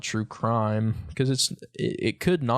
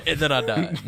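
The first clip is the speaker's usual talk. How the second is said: laughing; 5 semitones higher than usual. Pretend. Repeating the joke laughing.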